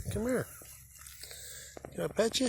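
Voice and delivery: high-pitched pet-talk pleading; coaxing an animal. Real, not acted. Coaxing a cow to come over.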